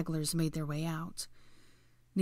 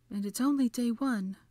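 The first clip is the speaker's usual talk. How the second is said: high, grim remark; 6 semitones higher than usual. A narrator's reading. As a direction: add it grimly, pitched up. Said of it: "Hermione whispered grimly".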